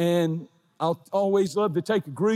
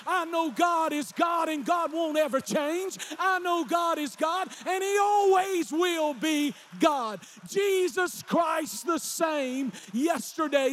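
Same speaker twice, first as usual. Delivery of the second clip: very high, full-force revival cry; shouted preaching; 12 semitones higher than usual. Real, not acted. Preacher crying out to the congregation that God won't change, high shouted preaching. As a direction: holler it like a revival climax.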